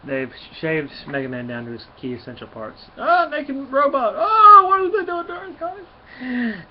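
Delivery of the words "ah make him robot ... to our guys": much higher, slow, hammed-up sobbing cry; mock wailing. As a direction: whine it like a heartbroken kid, exaggerated. Reacting to robot being stripped.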